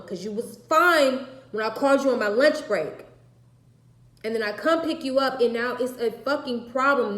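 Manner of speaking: furious yelling. Retold or imitated. Mother yelling at her daughter in the car, furious. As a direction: yell it at her, fed up.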